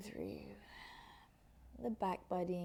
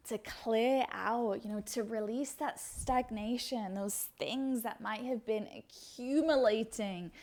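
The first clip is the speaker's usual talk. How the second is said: ordinary talk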